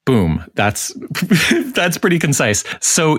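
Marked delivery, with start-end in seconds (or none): 1.0-1.7 short laugh. Real, not acted. Host laughs.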